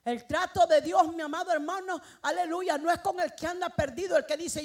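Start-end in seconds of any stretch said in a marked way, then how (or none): none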